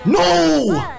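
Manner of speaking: high, drawn-out cry of dismay; dismayed shout. Real, not acted. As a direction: cry out as the crucial play fails. Real cry at failed crucial play.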